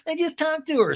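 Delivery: very high mocking echo; mock it back in a squeaky pitch; Ariana. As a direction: imitate a woman parroting in falsetto. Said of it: "And Ariana's like".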